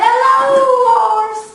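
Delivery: high squeaky duck greeting; bright high duck hello. Acted. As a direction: chirp it like a cheery duck.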